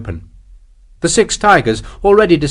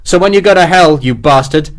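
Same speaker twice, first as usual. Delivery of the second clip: vengeful shouting; 7 semitones higher, 6 dB louder than usual. Acted. Sharp's final vengeful threat.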